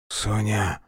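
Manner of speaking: barely a rasp from a dying man; wounded Mitya calling Sonka, wheezing; hoarse wheeze. Acted. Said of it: "просипел Митя".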